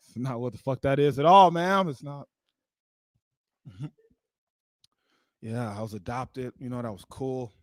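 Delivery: ordinary talk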